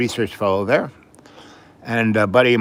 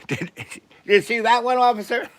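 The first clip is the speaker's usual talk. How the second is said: mocking tattling kid; 13 semitones higher than usual. The kid tattling to the cop, high mocking whine. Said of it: "the kid goes".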